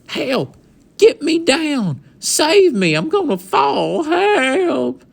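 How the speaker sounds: high, loud panicked yelling for help; tiny cowboy hanging on, begging to be saved in panic; panicked cries. Acted. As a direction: scream for rescue, about to fall. Cowboy dangling from cupboard.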